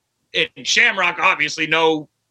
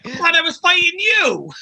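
panicked; 11 semitones higher than usual. Pretend. Imagined scared wrestling fan.

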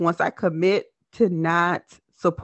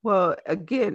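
ordinary talk

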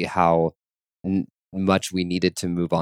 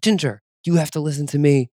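a higher, firm command to the dog; stern scolding; 7 semitones higher than usual. Retold or imitated. Imitating herself sternly scolding her dog Ginger at the dog park. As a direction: lay down the law to a puppy.

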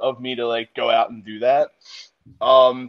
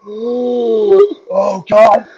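gasp, then a high, loud groan; mock agonized gasp and groan, building louder; imitating being winded; 6 semitones higher, 7 dB louder than usual. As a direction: act out sucking air after a slam. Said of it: "knocked all of the wind out".

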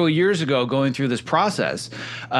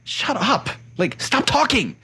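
mock telling-off, much higher; 10 semitones higher, slower than usual. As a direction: shoo the inner voice away, high and dismissive. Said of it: "now I can say".

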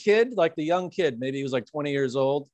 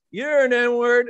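higher, louder mock drunk yell; drunk man yelling; 6 semitones higher, 5 dB louder, much slower than usual. Retelling the drunk man shouting at the narrator, mock yell. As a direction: bark it at him like a drunk picking a fight. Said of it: "he goes".

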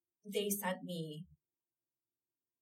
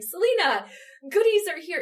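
high, loud singsong announcement; cheery note; 11 semitones higher, 15 dB louder than usual. Retold or imitated. Reading the playwright's note inside the package. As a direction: call it out like a happy surprise.

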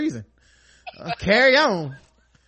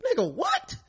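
mock challenge; 9 semitones higher, 4 dB quieter than usual. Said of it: "And you just like".

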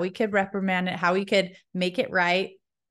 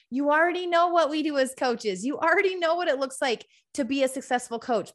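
bright, pitchy sales pitch for her coaching team; upbeat ad read; 3 semitones higher, 3 dB quieter than usual. Acted. Host pausing her show to recruit women, cheerful ad read. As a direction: sell the mentoring program with cheer.

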